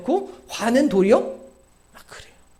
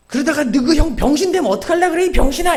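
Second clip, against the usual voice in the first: shouting at his little brother, louder and higher; angry shout; 9 semitones higher, 8 dB louder than usual. Retold or imitated. Big brother scolding his little brother, angry shout. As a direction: yell it hot, like a furious big brother.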